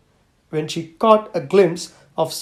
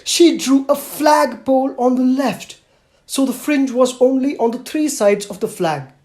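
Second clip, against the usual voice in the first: higher, patient explaining voice with a weary edge; tired but kind teacher; 7 semitones higher, 4 dB louder than usual. Acted. Teacher explaining the flagpole to Mother, tired but patient. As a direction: spell it out patiently, though worn out. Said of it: "getting tired, the teacher was kind enough to explain".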